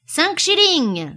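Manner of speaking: high, loud, drawn-out call; shouted bid. A narrator's reading. Heckler making the crowd laugh with a bid, calling out. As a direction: toss out a bid across the room. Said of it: "lança une voix".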